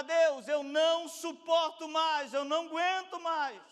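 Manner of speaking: loud, high, drawn-out plea; crying out to God. Acted. Believer at breaking point begging God, crying out. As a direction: cry for help at full force. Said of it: "clamar, pedir socorro a Deus".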